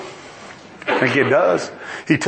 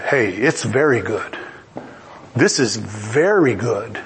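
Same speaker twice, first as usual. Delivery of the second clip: lightly voiced God, low and satisfied; God surveying creation; 9 semitones lower, slower than usual. God sitting back from his finished creation, low and satisfied.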